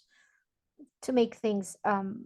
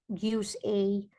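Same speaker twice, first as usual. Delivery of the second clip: ordinary delivery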